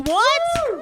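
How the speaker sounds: high, loud one-word whoop; mock excited disbelief. Comedian revealing nursing home job, mock-amazed shout. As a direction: belt it out to the crowd. Mock surprise at own statement.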